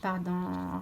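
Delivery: ordinary talk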